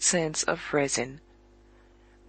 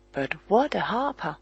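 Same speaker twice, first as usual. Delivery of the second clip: plain reading voice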